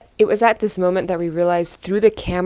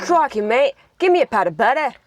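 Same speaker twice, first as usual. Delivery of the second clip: higher hammed-up Australian; Australian accent; 4 semitones higher, 4 dB louder than usual. Shown as a demonstration. Sibling demonstrating Australian for viewers, exaggerated accent. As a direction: put on a cartoon Australian accent. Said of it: "The Australian".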